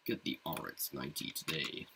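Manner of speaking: deep, booming movie trailer announcer; like a movie trailer voiceover. Pretend. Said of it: "You sound like the, uh, trailer guy".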